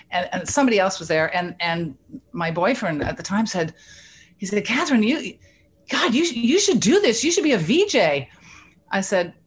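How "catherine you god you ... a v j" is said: excited urging. Retold or imitated. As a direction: voice jumps high, eager and pushing. Boyfriend urging her to be a VJ.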